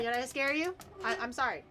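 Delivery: normal talking voice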